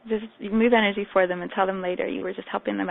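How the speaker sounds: ordinary delivery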